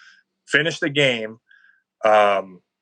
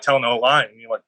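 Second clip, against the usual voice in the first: ordinary talk, nothing marked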